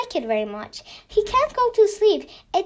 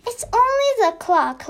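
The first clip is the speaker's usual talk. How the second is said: usual speaking voice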